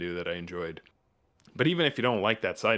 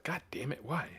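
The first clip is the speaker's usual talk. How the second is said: mock frustration; 6 semitones higher, 8 dB quieter than usual. Frustrated mock cry over sales.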